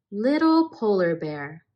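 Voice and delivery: gentle, lively storybook reading; reading to children. A narrator's reading. Narrator reads a polar bear tale to children, gentle storybook voice. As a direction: read it like a bedtime picture book. Reads picture book aloud.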